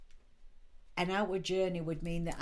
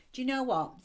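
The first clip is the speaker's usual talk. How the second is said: abrupt and harsh; 7 semitones higher, 5 dB louder than usual. Retold or imitated. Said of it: "abrupt and harsh".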